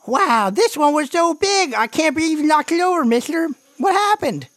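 put-on very high, eager kid voice; squeaky, rushed kid character; excited little brother. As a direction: gush like a thrilled little brother. Game master voices little brother.

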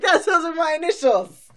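high, loud excited squeal; mock surprise. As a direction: squeal with delight at the coincidence. Retold own surprised reaction.

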